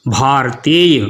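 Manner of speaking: भारतीय with a half र, bhaar-tiy; teacher's model, भारतीय not bha-ra-tiy; correct pronunciation. Shown as a demonstration. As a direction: say भारतीय as bhaar-teey, no vowel after र. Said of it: "उसको आधा ही पढ़ना ज़रूरी है".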